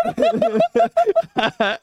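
shrieking giggle fit; real high-pitched cackle; high helpless laugh. Real, not acted. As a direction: laugh till it squeaks. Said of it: "I'm crying now".

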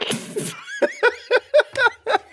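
high burst of laughter. Real, not acted. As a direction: shriek with laughter. Big laugh at the joke.

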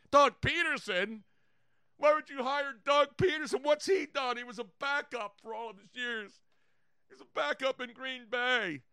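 high, whiny indignant fan; mock a fan in disbelief at the hire; hammed-up incredulous complaining; outraged fan. Acts out outraged fans' complaints.

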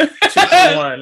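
laughing through the numbers; giggling while counting. Real, not acted. Laughing at the order.

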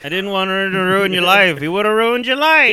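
high, loud hammed-up pleading; mock father's excuse. Dying father justifies himself to his daughter, hammed-up. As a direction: ham up the dad's lame excuse.

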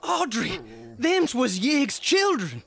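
high, slow, scared plea; frightened. Acted. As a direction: dread in every word. Terrified husband after snakes killed.

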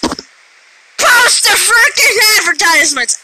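sudden drawn-out shout; playful shouted rant; shouted outburst. Real, not acted. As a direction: burst into a loud, slow holler. Mock-ranting loudly about monetization.

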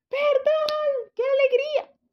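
muy agudo y exaltado; sorpresa feliz. Retold or imitated. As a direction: grita de alegría ante la sorpresa. Reacción a mellizos inesperados. (exclamación de alegría, muy aguda)

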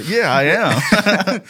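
slow, drawn-out proud hero voice; hammed-up hero voice. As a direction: stretch it out like a proud superhero. Quoted reply in meme story.

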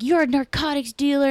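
high-pitched imitation of an accusing neighbor; mock accusation. Acts out old neighbor's accusation.

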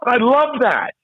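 high, bright-eyed delight retold; excited praise. Host recalls gushing to the guest, high excited delight. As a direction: gush over the guest's comeback.

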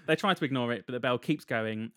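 ordinary talk

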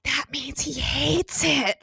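slow stage whisper, too loud to be secret. Retold or imitated. Patty leaning to Warren, drunk loud whisper. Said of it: "loudly whispering to Warren".

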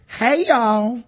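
best country accent. Shown as a demonstration. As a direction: call it out in a put-on country accent. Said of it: "in my best country accent".

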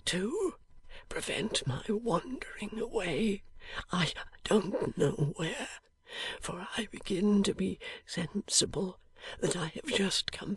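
barely voiced, dying breath; a dying man's faint whisper. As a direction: whisper it weakly from a sickbed.

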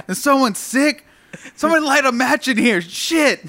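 loud, high retold outburst; mock an old man appalled by the stink; imitated disgusted complaint. Retelling old man's disgusted complaint.

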